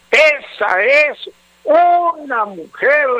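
high, forceful stress; emphatic praise. Real, not acted. Adviser defending a wife to her doubting husband, high and emphatic. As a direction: lift it high and drive each word home.

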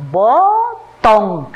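"botón" stretched into bo, tón; separado en sílabas. Shown as a demonstration. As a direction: sound out "botón" with two long, firm syllables. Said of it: "luego vamos a separar en sílabas". ("botón" alargado, con pausa entre bo y tón)

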